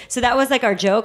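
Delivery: ordinary talk, nothing marked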